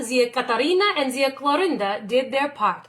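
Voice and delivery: plain reading voice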